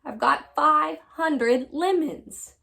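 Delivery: slow, high, loud lament; drawn-out storybook wail of worry; moaning. A narrator's reading. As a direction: moan each word out in dismay. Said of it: "she moaned".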